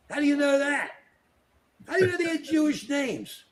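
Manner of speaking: high-pitched mocking questions; drawn-up, theatrical "how do you know?"; mock incredulity. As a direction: put on a high voice of disbelief.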